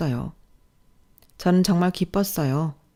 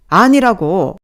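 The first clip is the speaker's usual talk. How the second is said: "아니라고" shouted much louder, pitch jumping; scolding shout; 3 semitones higher, 10 dB louder, much slower than usual. Acted. Boss rejecting the form, furious shout. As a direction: explode on "아니라고". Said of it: "혼이 나고 있었어요".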